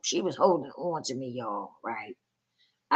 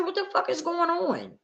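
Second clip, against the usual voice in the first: high-pitched retold outrage; a replayed angry challenge; outraged question; 12 semitones higher, 4 dB quieter than usual. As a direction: demand answers, incredulous. Retold angry confrontation with caseworker.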